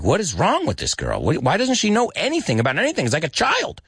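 high, rushed exasperation; acted fed-up complaint; losing patience. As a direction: vent in disbelief, pitch climbing. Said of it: "he's losing his patience".

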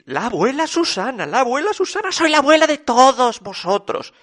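high, insistent declaration; proclaiming grandmother. A narrator's reading. Susana announcing herself grandmother of all, high proclamation.

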